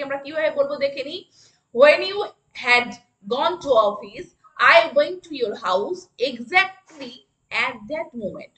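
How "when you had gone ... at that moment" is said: very slow, word by word; slow classroom dictation. Shown as a demonstration. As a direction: spell it out slowly for the class. Teacher models a sentence.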